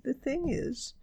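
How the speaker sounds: high, slow, hesitant question; nervous young bear. A narrator's reading. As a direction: ask anxiously as a little bear. Paddington's knees wobble, he worries.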